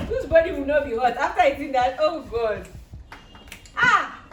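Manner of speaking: loud pained exclaim; loud burning reaction. Real, not acted. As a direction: cry out as the taste burns. Burning from bitter kola.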